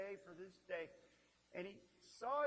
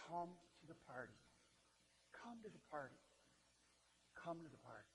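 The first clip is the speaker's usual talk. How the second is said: soft, pleading invitation from the father; tender plea; 3 semitones lower, 14 dB quieter than usual. Retold or imitated. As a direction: coax him in gently, almost hushed. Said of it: "his father's words are interesting".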